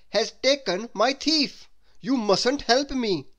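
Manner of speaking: loud, slow, high character voice; Mister Topsy-Turvy explaining. A narrator's reading. Mister Topsy-Turvy reports to the police, agitated. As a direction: spell it out loudly and urgently. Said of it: "he explained".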